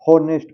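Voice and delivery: हॉनेस्ट opening on a clear h; h sounded. Shown as a demonstration. Teacher showing learners the unsilenced reading, h sounded in हॉनेस्ट. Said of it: "अगर silent नहीं करते तो".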